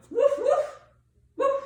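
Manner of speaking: high, loud playful woofs; dog bark. Shown as a demonstration. Yoga teacher showing kids dog sounds, loud barking. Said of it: "you can also make the sound".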